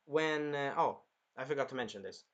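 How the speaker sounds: ordinary talk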